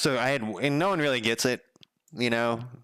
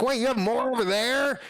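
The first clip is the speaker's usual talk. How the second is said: high, astonished question; pitched-up amazed stranger; stunned disbelief; 13 semitones higher than usual. Retold or imitated. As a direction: play the guy who can't believe it. Delivery guy stunned by more books.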